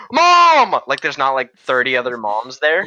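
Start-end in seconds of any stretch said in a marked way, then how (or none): 0.1-0.8 high shout across a crowd; calling out. Retold or imitated. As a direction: call for mom over the noise. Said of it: "and I go".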